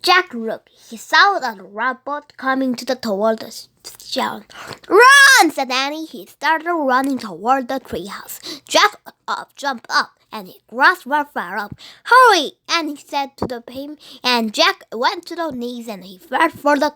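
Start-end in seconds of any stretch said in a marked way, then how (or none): 4.9-5.5 loud high cry to run; urgent shout; shouted warning. Acted. Children fleeing pirates. 12.0-12.5 loud high hurry; urgent shout; urgent call. Acted. Fleeing pirates.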